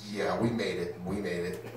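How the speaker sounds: low, soft somber reply; hushed fake war-weary veteran; solemn mock veteran. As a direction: play the weary survivor, quietly. His solemn veteran act.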